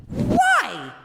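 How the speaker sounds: high, baffled, drawn-out why. Retold or imitated. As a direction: squeak it out in disbelief. Retorting to catcaller, high pitch.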